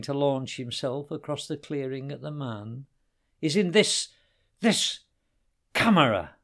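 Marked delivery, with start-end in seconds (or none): 3.4-6.3 high, loud menacing growl; growled threat. A narrator's reading. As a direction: spit the last word as he lunges. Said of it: "he growled".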